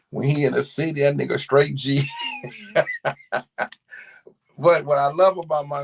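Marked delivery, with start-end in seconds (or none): 2.1-4.0 high, breaking-out laugh. Real, not acted. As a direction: laugh at your own story, high-pitched. Laughs about his son.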